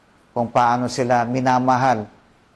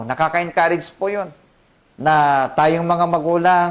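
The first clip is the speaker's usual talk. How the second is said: normal talking voice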